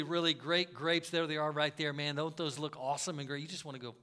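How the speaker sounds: ordinary talk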